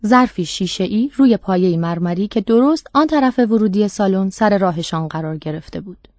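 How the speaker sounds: ordinary delivery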